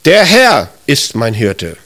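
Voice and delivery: slow, weight on "Herr", rest dropping low; stress on HERR. Shown as a demonstration. Preacher shows first emphasis of the verse, stress on "Herr". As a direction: lean on "Herr", then let the voice fall. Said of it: "Damit betonst du der Herr".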